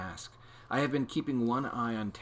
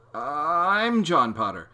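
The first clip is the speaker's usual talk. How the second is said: slow confused protest; 7 semitones higher, 11 dB louder, much slower than usual. A narrator's reading.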